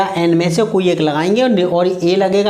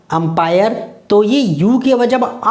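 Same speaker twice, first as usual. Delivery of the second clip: ordinary talk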